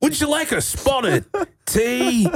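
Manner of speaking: high, hammed-up English accent; mock English accent. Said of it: "do an English accent right there".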